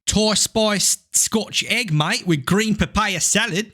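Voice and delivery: mock Australian accent, slow and high; jokey Australian twang. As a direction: play an Aussie reading the menu. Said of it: "steer the accent back to where it needed to be".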